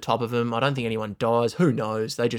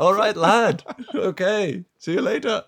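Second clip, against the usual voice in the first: put-on Scouse accent; 8 semitones higher than usual. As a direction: brush him off like a chirpy Scouser. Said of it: "let alone Scouse people".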